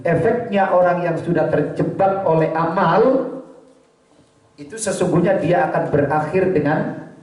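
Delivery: normal talking voice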